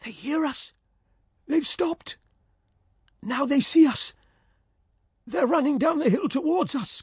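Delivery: high, excited shouting; excited cry. Acted. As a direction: cry out the good news. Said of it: "cried Ulrich".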